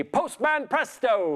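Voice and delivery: slow, grand magic-word incantation. Pretend. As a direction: intone it like a party magician. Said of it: "Everyone say the magic words".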